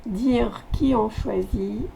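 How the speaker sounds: ordinary talk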